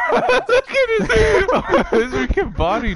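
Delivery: high, loud laughing words. Real, not acted. Laughing at teammate's character.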